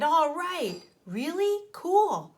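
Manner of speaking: high, bright cheering; excited kids. A narrator's reading. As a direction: cheer like a thrilled kid. Kids excited about Waterland.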